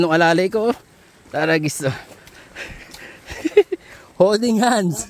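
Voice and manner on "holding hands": laughing. Real, not acted. Teasing about holding hands.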